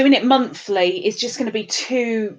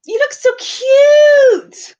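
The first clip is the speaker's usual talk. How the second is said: high, loud, drawn-out squeal; hammed-up high-pitched gush; gushing compliment; 8 dB louder, much slower than usual. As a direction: squeal it with a long stretched cute.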